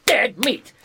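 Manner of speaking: gleeful loud echo of dead meat; emphatic repeat. Real, not acted. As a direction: slam the words dead meat home.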